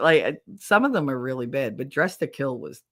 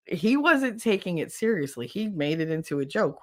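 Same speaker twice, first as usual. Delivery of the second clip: ordinary talk, nothing marked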